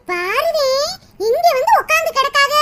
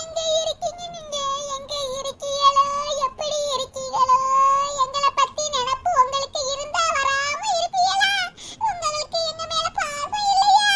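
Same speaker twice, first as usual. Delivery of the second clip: soft, sobbing plea to an absent husband; sudden weeping; 3 dB quieter than usual. Acted. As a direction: wail each question as a lament. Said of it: "அம்மா இப்ப ஏன் திடீர்னு அழுகுறாங்க".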